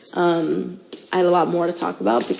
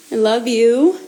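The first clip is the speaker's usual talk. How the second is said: usual speaking voice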